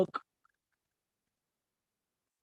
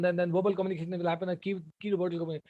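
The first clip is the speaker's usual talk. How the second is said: too fast; 7 dB quieter, much faster than usual. Shown as a demonstration. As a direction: rush through, softer and very fast. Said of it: "Are you able to understand anything?".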